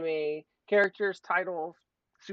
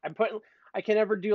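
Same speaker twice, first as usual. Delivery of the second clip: normal talking voice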